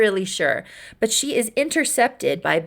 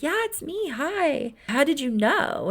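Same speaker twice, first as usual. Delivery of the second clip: light up shyly in a higher voice; excited warmth; 7 semitones higher than usual. Retold or imitated. Girl greeting her crush as she turns around, excited warmth. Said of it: "excited and filled with this warmth".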